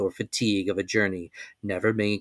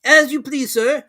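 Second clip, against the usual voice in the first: higher, even, unbothered servant voice; calm servant; 8 dB louder than usual. A narrator's reading. Servant accepting the sudden departure, calm and higher. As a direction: agree without any fuss, voiced high. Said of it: "replied Conseil quietly".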